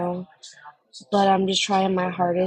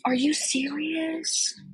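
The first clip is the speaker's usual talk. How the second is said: amazed disbelief; 8 semitones higher, 4 dB quieter, much slower than usual. Real, not acted. Hearing Connie is working.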